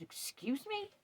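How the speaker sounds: high, rising offended Excuse me; repeating Excuse me in shocked outrage; indignant disbelief. Retold or imitated. Camp host stunned by the neighbor's insult, indignant rise.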